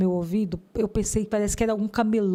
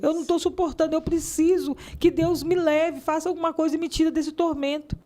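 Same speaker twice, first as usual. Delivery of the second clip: higher-pitched, pleading outpour; retold desperate plea; 5 semitones higher than usual. Woman retelling her plea to a passing couple, desperate. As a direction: re-enact begging for relief from torment.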